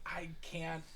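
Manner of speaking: breathy whisper, trailing off in confusion; lost-in-thought whisper; frazzled whisper. Retold or imitated. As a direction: mutter to yourself as if your head hurts. Player mimics frazzled thinking.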